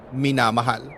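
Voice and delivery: plain reading voice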